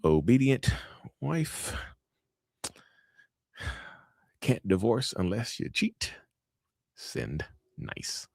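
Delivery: slow, drawn-out self-satisfied muttering; scheming scripture-sender. Acted. Acts abuser picking scriptures to send.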